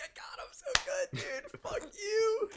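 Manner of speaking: squeaky high cackling glee; laughing at his own joke as Ben; gleeful laughing. Retold or imitated. Ben gloating at the organizer with high laughing glee. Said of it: "But it's like".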